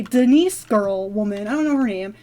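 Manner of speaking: usual speaking voice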